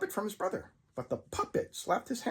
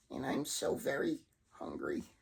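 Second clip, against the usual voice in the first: much lower, soft rasping monster voice; deep hungry monster; 6 dB quieter than usual. Acted. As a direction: breathe it like a beast about to feed. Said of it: "voice noticeably began to deepen and echo".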